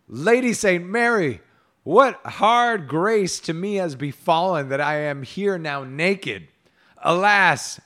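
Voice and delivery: loud, high theatrical wail of woe; miserable lament. A narrator's reading. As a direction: bemoan your plight with hammed-up misery. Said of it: "He looked up full miserably and said".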